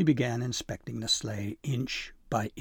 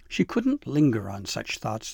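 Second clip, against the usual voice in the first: ordinary delivery, nothing marked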